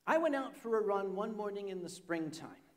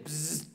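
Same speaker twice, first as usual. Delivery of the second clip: short, quieter "bzzz" noise; imitated insect buzz; 5 semitones lower, 6 dB quieter than usual. Storyteller back in the room hearing it again, imitated buzz.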